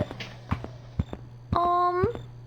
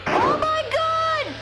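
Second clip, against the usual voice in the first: high, slow stunned cry; blurted in disbelief; shocked gasp; 10 semitones higher, much slower than usual. Real, not acted. Player reacts to the game, shocked.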